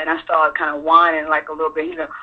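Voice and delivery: ordinary talk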